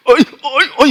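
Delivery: loud, blubbering mock wail imitating how men cry; manly crying. Shown as a demonstration. Pastor mimics men's crying, loud mock blubbering. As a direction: bawl it out like a grown man. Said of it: "남자들 / 이렇게 울잖아요".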